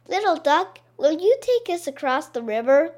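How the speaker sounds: high, polite asking voices; child voice. Acted. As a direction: plead sweetly with the duck. Children ask duck.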